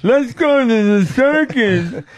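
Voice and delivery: high, slow giggly fool voice; mock rich fool. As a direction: play a giddy rich buffoon, laughing. Mocks a rich man's boast.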